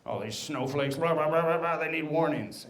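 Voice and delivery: grumble, then climb into a blah-blah rant; imitating whiny outrage over nothing; upset complainers. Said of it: "some people were upset about a warning".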